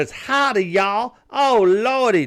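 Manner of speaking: high, hammed-up Southern twang; mock Southern accent. Comic pretending to pander in the South, mock Southern accent. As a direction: fake a Southern greeting for laughs. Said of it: "That'd be like me going down there".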